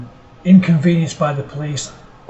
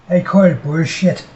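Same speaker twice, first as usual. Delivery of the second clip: a slightly bad Irish accent, punched louder; hammed-up Irish accent, said loud; Irish Demon's line; 3 semitones higher, 5 dB louder than usual. Vlogger retrying Irish Demon's line in a louder bad Irish accent. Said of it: "Slightly bad".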